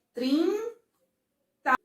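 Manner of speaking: "Trinta" as high long trin, pause, ta; syllable by syllable. Shown as a demonstration. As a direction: break "Trinta" into two separate beats. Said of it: "quantas vezes eu falei as sílabas".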